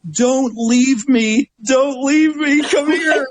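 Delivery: put-on pleading, high, drawn-out child voice; imitating a crying boy. Retelling the stung boy begging him to stay, high pleading child voice. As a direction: mimic the scared kid begging. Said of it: "he starts saying to me".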